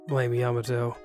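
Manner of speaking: plain reading voice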